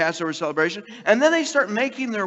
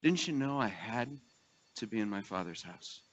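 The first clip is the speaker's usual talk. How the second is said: gentle quiet reply; 7 semitones lower, 12 dB quieter than usual. Retold or imitated. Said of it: "what does Jesus say".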